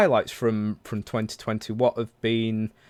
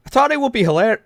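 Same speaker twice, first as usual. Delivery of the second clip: higher, louder stab at an Irish accent; attempted Irish accent; 11 semitones higher, 5 dB louder, faster than usual. Shown as a demonstration. Host trying to read a listener's story aloud, attempted Irish accent. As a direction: have a rusty go at an Irish accent. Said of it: "since I've done a Irish accent".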